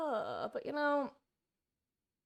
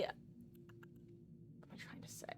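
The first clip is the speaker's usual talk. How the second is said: whisper; 20 dB quieter, much slower than usual. Real, not acted. Muttering to herself mid-thought.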